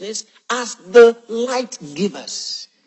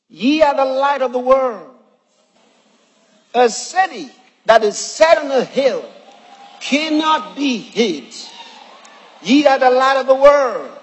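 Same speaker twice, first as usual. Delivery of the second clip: loud, high proclaiming of scripture; preaching; 5 semitones higher, 6 dB louder than usual. Real, not acted. Preacher proclaiming the verse to the congregation at full force. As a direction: declare it with force to the crowd.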